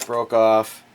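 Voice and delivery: ordinary delivery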